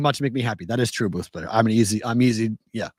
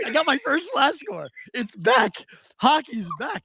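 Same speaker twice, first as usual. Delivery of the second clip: put-on pitched way up, gushing like a kid; bursting with glee over the hockey alert; giddy excitement; 12 semitones higher, slower than usual. To his wife, retelling his giddy joy, pitched high and gushing. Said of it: "I'm like".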